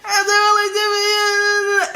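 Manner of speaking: high, hammed-up wailing plea; acted bawling, slowing and dragging out the end; mock crying. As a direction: wail it in one long high whine. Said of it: "And I start crying".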